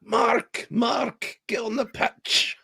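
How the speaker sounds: high-pitched, drawn-out Northern Irish call; hammed-up Northern Irish manager calling out; attempted Northern Irish accent. Manager calling Mark onto the pitch, hammed-up Northern Irish accent. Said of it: "He's never been Northern Irish".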